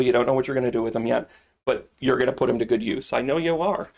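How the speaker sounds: ordinary delivery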